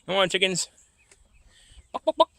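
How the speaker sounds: very high clucking call; calling chickens. Real, not acted.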